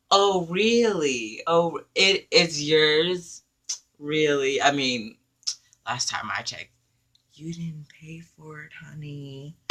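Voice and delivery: high, slow mock-sweet sass; sarcastic sass. Sarcastic comeback to thief.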